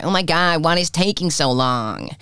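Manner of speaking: exaggerated American complaint; mock American accent. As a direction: impersonate an impatient American, dragging out the words. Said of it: "the American's like".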